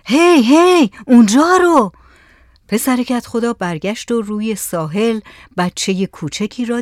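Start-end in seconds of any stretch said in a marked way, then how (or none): 0.1-1.9 high, loud call across the water. Acted. Saleh suddenly spots someone on the shore, calling out loudly. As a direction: shout out to point something out. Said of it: "با صدای بلند گفت".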